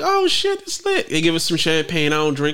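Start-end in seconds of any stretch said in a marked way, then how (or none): 0.0-1.1 high excited squeal. Retold or imitated. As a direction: squeal at the view. Retold own excited reaction.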